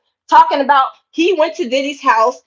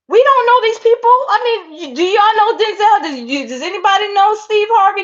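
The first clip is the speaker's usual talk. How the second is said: indignant outburst; 7 semitones higher than usual. Real, not acted. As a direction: challenge the audience in disbelief. Indignant rhetorical questions.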